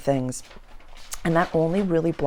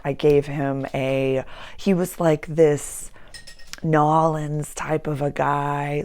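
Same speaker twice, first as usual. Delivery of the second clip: ordinary delivery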